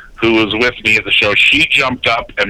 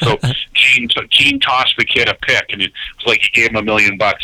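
ordinary talk, nothing marked

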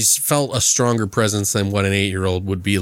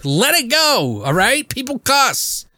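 high, incredulous mock exasperation; exasperated rant; 11 semitones higher than usual. To his cohost about the film's swearing, high exasperated mock rant. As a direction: throw up your hands at the cussing fuss. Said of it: "And I'm like".

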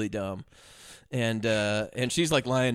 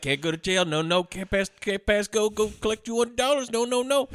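mock panicked refusal; 6 semitones higher than usual. Crook refusing to go to jail, mock rising panic. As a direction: pitch climbing in mock panic.

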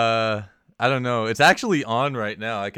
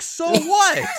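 much higher, louder mock outrage; incredulous retort; 6 dB louder than usual. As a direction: fire it back in disbelief, way up high. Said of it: "And I'm like".

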